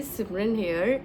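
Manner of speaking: normal talking voice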